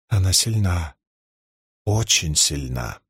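low, mildly reluctant concession; reluctant admission. A narrator's reading. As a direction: add it with understated reluctance, pitch dropping. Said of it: "с явной неохотой добавила".

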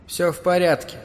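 a hint of bubbling from a swollen throat. A narrator's reading. Drowned woman reassures the boy from her swollen throat, faintly bubbling. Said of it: "булькающие слова из её опухшей глотки".

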